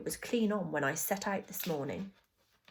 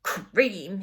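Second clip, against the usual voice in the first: loud, disgusted outburst; bitter complaint; 8 dB louder than usual. A narrator's reading. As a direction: spit out the word with bitter force. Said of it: "he said bitterly".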